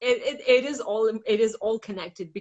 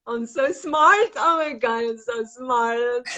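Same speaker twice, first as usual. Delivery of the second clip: smug self-praise; 4 semitones higher, 7 dB louder than usual. Retold or imitated. The clever mind mocked for figuring it out, loud and smug. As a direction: gloat like you just cracked the case. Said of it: "because we think, like".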